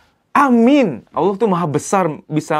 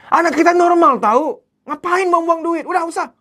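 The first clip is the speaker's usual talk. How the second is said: dismissive husband; 12 semitones higher than usual. Retold or imitated. Husband waving off his wife's worry, scoffing. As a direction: brush her off like a stubborn husband.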